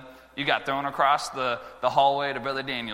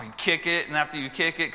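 normal talking voice

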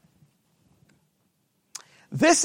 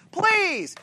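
high-pitched, drawn-out plea; pleading; 8 semitones higher than usual. Shown as a demonstration. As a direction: beg in a high voice. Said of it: "not like pleading".

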